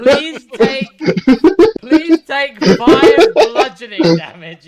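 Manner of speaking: can barely get it out for laughing; laughing narration. Real, not acted. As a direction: GM laughing at the tossed character. Laughing while narrating the throw.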